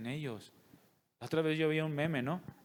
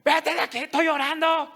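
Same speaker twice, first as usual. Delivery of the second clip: put-on much higher, much louder screaming at the kid; furious yell; 12 semitones higher, 12 dB louder than usual. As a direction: mom snapping at her son mid-prayer, yelling. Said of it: "Y entra el niño y". (grito furioso, mucho más agudo y fuerte)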